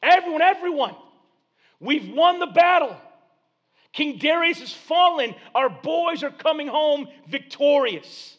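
high, loud proclaiming cry; herald's shout. Acted. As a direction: call out the victory news to the whole city. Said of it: "He's saying".

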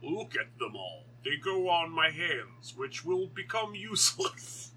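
slow, hammed-up fake American accent; Benedict Cumberbatch. Podcaster spoofing Doctor Strange's watches, slow mock American accent. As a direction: drag out a badly faked American accent. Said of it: "his American accent in that is".

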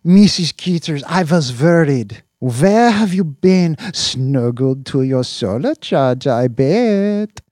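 put-on high, loud baby talk to a pet; doting cat talk. Owner doting on his cat on screen. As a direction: dote on the cat.

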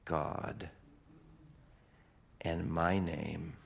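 low, very slow, long pauses; solemn revelation. Acted. Preacher as Jesus revealing himself to Paul, slow fading solemnity. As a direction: fade gradually to a whisper.

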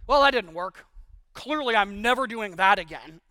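loud fed-up give-up; frustrated quitter. Acted. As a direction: throw up your hands, loudly. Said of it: "do you stop gardening altogether".

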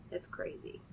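breathy whispered aside. Real, not acted. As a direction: whisper it to yourself. Aside reacting to statistics.

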